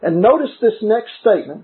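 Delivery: ordinary delivery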